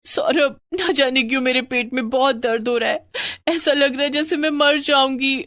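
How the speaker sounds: wife in pain pleading with husband; pained plea. Acted. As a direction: tell him you feel you'll die.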